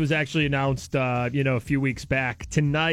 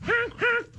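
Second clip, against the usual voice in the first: shrieking high-pitched cackle; wild high cackle. Real, not acted. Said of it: "such a contagious laugh".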